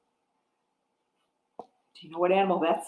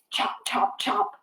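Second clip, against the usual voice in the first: whispered chop; 6 dB quieter than usual. Acted. Alligator jaws in song.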